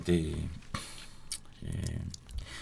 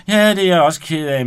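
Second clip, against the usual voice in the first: loud excuse; 7 semitones higher, 10 dB louder than usual. Acted. Åge excuses himself.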